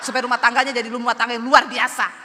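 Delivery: loud mock-grand exasperation; sarcastic outburst. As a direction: drive the retort home at full volume. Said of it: "Saya tambah emosi nggak?".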